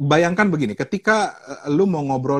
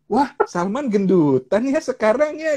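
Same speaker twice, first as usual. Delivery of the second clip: mock acquaintance remark; 11 semitones higher, slower than usual. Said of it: "kita ketemu orang terus bilang".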